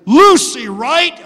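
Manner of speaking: high, loud banter; knowing guess. Retold or imitated. Pastor and third driver trading quick loud lines. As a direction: call it out before she asks.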